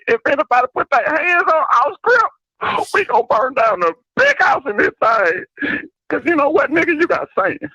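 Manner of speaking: squeaky high-pitched caller voice; high put-on voice.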